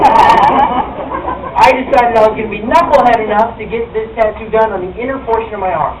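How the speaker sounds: ordinary delivery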